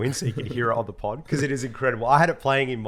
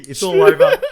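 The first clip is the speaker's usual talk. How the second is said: laughing; 10 semitones higher, 15 dB louder than usual. Real, not acted. Laughing at the video.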